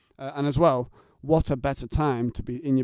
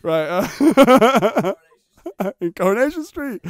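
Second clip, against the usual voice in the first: high, laughing joke delivery; telling a joke while cracking up; laughing through a joke; 7 semitones higher, 7 dB louder, slower than usual. Real, not acted. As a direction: giggle through the punchline. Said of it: "it was a tram joke".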